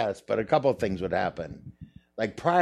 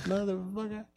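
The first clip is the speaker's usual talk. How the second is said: impersonating Richard Pryor; 12 semitones higher, 6 dB quieter than usual. Retold or imitated. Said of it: "him saying something like that".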